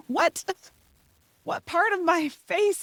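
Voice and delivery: high-pitched mock astonishment; incredulous. Mother stunned son thought she was mad, incredulous.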